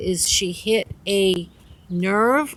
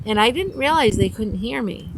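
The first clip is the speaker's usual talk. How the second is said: ordinary delivery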